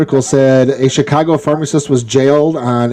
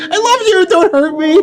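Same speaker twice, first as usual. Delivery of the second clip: laughing. Pretend. Teasing after a jab, laughing.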